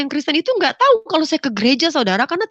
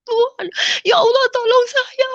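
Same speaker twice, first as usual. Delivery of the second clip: much higher tearful cry for help; crying shout; 11 semitones higher than usual. Retold or imitated. Retelling her cry to God in church, shouting through tears. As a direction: wail it out to God. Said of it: "Saya teriak kepada Allah saya".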